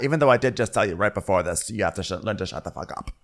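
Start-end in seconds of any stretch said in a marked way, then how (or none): none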